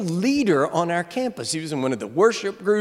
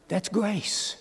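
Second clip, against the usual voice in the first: much softer, reverent statement; hushed grace; 8 dB quieter than usual. Real, not acted. Preacher softly repeating the word grace, hushed. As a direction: let it land quietly.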